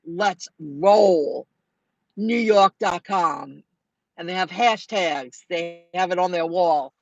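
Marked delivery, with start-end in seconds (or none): 0.0-3.6 Let's Roll New York dot com read out slowly, word by word; slow spelled-out name. Shown as a demonstration. To viewers giving the shop's web address, slow and clear. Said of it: "not Let It Roll".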